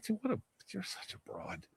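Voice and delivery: muttered put-down, under the breath; whispered scorn. Retold or imitated. Narrator scoffing at the screaming inmate, whispered contempt. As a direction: sneer it quietly to yourself.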